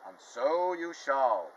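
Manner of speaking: loud, slow and grand. Acted. Hairy godmother announcing herself, loud and slow. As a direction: boom it out slowly from above. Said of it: "came a loud voice".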